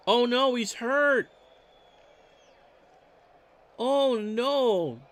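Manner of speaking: sudden high, loud "oh no"; real dismay. Real, not acted. Announcer reacting live to the injured pitcher, alarmed. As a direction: caught off guard as the pitcher goes down.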